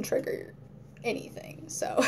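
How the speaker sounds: whisper. Real, not acted.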